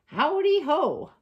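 high, loud cheery hail; hare's greeting. A narrator's reading. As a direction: sing out the greeting as a bouncy hare. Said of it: "Hare hops along and says".

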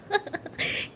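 breathy high giggle. A narrator's reading. Ishani giggling while teasing Pari. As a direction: giggle mockingly. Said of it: "ज़ोर से हंसते हुए कहती".

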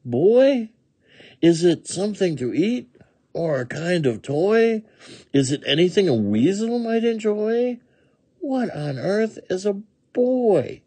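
high-pitched, questioning weasel voice; puzzled weasel. A narrator's reading. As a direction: puzzle aloud in a high critter voice. Weasel figure in story.

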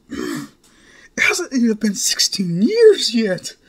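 high-pitched, bewildered mother firing questions; flustered mother. Acted. Mother grilling her son about the grandchildren, high and bewildered.

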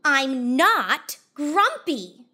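loud, slow, stretched-out denial; shouted. Acted. Jim shouts at the others, loud and slow. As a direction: bellow it like a sulky ape. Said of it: "shouted Jim".

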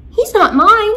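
high, loud protest; indignant protest. Acted. Georgie protesting to Miss Knight, loud and indignant. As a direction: blurt it out defensively.

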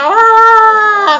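loud high mock wail of the creatures; imitating scared cry. Player mimicking his idle tribe members, mock wail. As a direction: ham up the creatures' panicked ah. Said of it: "tutti gli altri stanno lì e fanno".

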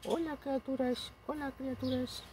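very high squeaky voice greeting the bunnies; cutesy baby voice. Pretend. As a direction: coo at the rabbits in a tiny high voice. (voz aguda de bebé saludando a los conejos)

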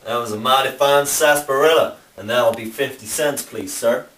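friendly American Southern bartender voice, lightly put on; mock Southern accent. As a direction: greet a stranger like an old-time saloon keeper in a Southern accent. Cowboy bartender role.

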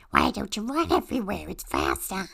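ordinary talk, nothing marked

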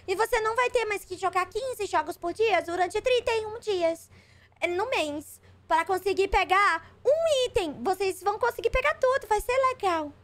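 high, sugary mock promo voice; mocking the announcers. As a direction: parody the company's hype in a high sweet voice. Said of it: "Porque toda vida eles falam".

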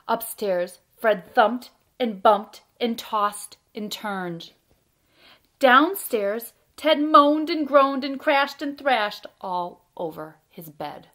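loud, deliberate picture-book narration with pauses; reading to children. A narrator's reading. Storyteller reads a bedtime tale about Fred and Ted, lively read-aloud. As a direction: read it out with big, bouncy emphasis.

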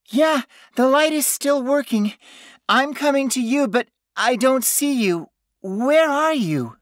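very high, loud, anxious call; frightened calling. A narrator's reading. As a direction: call back searching for him. Frightened woman calls to lost friend.

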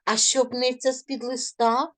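expressive reading of a spring poem. Shown as a demonstration. Teacher reading a spring poem to pupils, expressive. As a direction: read it like a question-and-answer verse.

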